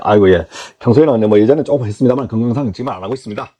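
low-pitched, polite model answer; humble candidate. Acted. Candidate reassuring interviewer that he quit for health, humble.

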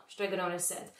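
usual speaking voice

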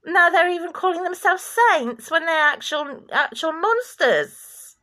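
loud, high, outraged denunciation; indignant outburst. Real, not acted. Indignant accusation at climax of rant.

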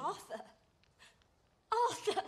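stunned, hushed. Acted. Shocked at hearing husband's name.

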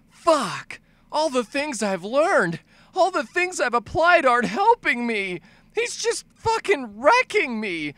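high, drawn-out distressed whine; panicking player. Acted. Player being wrecked by the boss, panicked wail. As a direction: wail helplessly as a losing gamer.

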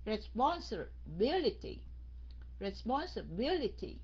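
"Responsibility" slowly, split into two halves; broken in half. Shown as a demonstration. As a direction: say "Responsibility" as respons, pause, bility. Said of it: "Mình cắt nó ra phân nửa". ("Responsibility" tách làm hai nửa, đọc chậm)

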